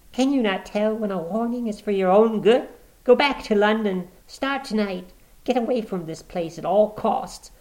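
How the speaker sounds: high, pressing woman's voice; urgent warning. Acted. Woman warning Watson to leave tonight, urgent. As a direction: urge him to flee at once.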